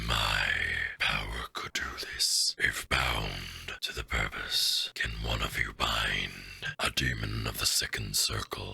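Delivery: deep whispered rumble from the mountain; low, slow, breathy demon voice; rumbling mountain voice. Acted.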